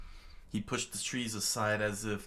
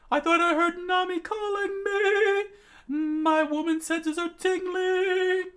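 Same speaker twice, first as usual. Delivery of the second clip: very high, loud cartoon squeal; sing-out falsetto, drawing out the ends; Sanji's giddy squeal; 8 dB louder than usual. A narrator's reading. Narrator voices Sanji thinking Nami called him, giddy squeal. Voicing Sanji, love-struck.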